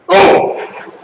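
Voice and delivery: sharp, high cut-off cry; abrupt stop-that exclamation; shutting it down. Shown as a demonstration. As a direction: cut them off with one short yelp. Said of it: "when you have to shut it down".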